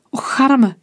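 high-pitched lament; wailing. A narrator's reading. Pale woman pitying Julien, wailing in a high voice. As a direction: lift the voice into a woman's wail of pity. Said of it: "jammerde een bleke vrouw".

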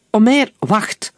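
slow, high and loud order; command. A narrator's reading. Captain stops Omer from shooting the scout, loud slow command. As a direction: halt him with a stern, drawn-out call. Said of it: "beval de kapitein".

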